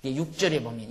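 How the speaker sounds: ordinary talk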